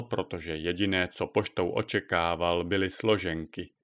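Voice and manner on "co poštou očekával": ordinary delivery, nothing marked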